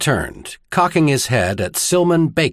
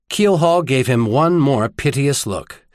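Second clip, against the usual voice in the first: plain reading voice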